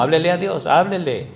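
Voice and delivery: normal talking voice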